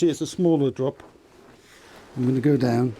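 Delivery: ordinary talk, nothing marked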